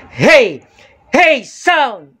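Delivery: shouted sound check call; shouting. Shown as a demonstration. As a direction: yell it to hit the orange. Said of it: "sumisigaw ako".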